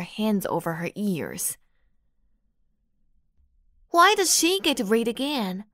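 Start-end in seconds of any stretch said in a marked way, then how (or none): 3.9-5.6 high, whiny complaint; high-pitched voice. A narrator's reading. Sandra whining to the teacher, high-pitched and petulant. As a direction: whine it up high like a spoiled girl. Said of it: "Sandra said in a high-pitched voice".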